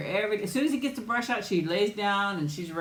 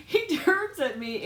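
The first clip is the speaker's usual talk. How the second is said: ordinary delivery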